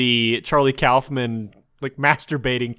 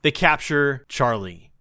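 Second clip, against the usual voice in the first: usual speaking voice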